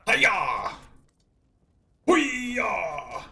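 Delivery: high martial arts battle cry; karate yell. Pretend. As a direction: shout it like a kung fu master. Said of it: "I shall show you the power of Lizard Fu".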